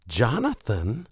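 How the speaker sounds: high, slow scandalised cry of his name; you've gone too far, tell him; outraged protest. Acted. Girlfriend scolding him for going too far, outraged. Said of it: "a tone meant to convey that I'd gone too far".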